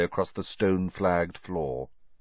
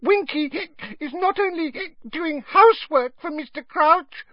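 very high, hiccuping elf protest; tipsy little elf, offended; indignant squeak; 3 dB louder than usual. A narrator's reading. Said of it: "Winky squeaked indignantly".

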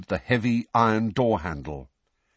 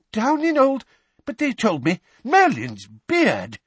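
shocked; 13 semitones higher, 5 dB louder than usual. A narrator's reading. Weasley shocked by news.

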